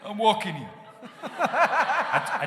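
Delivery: hammed-up New York cabbie shout; New York accent. Shown as a demonstration. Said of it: "I do a really bad New York accent".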